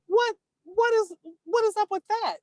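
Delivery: high, indignant demand, stumbling at the start; outraged question. Retold or imitated. Mother confronting the preschool teacher, indignant high demand.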